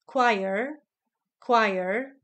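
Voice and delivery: "Choir" pronounced KWAI-er, starting with a K, nothing like its spelling; "Choir" as KWIRE. Shown as a demonstration. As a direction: tell them it sounds KWAI-er. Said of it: "semmi köze a leíráshoz".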